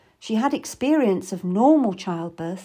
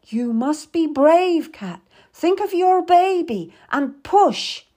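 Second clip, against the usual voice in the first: loud, high, drawn-out exhortation; rally her to push; urgent birth coach, voice raised; forceful urging; 7 semitones higher, 6 dB louder, slower than usual. A narrator's reading. Meady urges mother to push.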